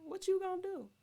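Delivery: high, loud, scoffing question; mock him in disbelief; incredulous scoff. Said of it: "that was my response".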